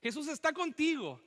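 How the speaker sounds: usual speaking voice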